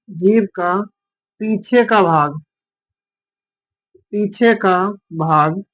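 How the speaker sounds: slow, spaced-out dictation with long pauses; teacher dictating notes slowly. Acted. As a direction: read each phrase for students to copy down. Dictating a definition.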